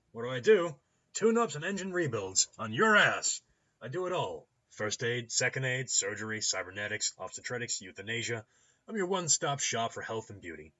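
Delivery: loud, high, punchy sales pitch; boisterous clinic owner. Acted. Rigging doctor pitching services to a customer, boisterous. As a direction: reel off the services with gusto.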